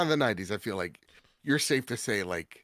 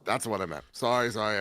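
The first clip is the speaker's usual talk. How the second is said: normal talking voice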